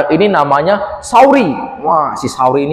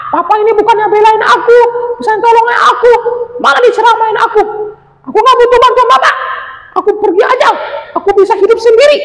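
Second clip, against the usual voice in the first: very high, loud threatening shout; furious squeaky creature yelling; angry threat; 4 dB louder, faster than usual. Acted. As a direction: threaten the fleeing porcupine. Anjani storms off from father.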